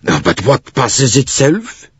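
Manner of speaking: put-on mildly angry, drawn-out accented demand; a touch of exasperation, rising. A narrator's reading. Bouc demands an explanation from the conductor, mildly angry. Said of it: "demanded Monsieur Bouc angrily".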